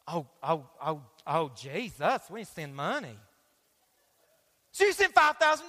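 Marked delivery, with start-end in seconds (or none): 0.1-3.2 low, soft hammed-up fake sobbing; mock fake crying. Preacher's wife fake-crying on TV, mock whimpering. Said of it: "his wife with purple hair is crying".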